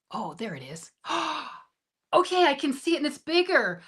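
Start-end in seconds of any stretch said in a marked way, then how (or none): 1.1-1.6 a quick, high gasp of delight; soft pleased gasp. Real, not acted. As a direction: gasp at the bigger chat window. Sees the chat appear.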